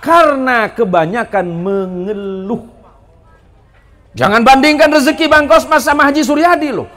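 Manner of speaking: loud, rising sermon delivery; preacher exhorting the congregation to stop complaining; full-force preaching. Acted. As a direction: drive each question home to the crowd. Preaching to the crowd.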